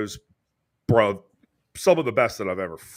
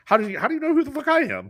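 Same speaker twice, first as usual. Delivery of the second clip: amazed disbelief; 14 semitones higher, faster than usual. Real, not acted. Incredulous question.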